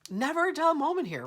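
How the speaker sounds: high, loud bright exclamation; excited quip. Real, not acted. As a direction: toss it out high and loud, amused. Laughing off the vent scare.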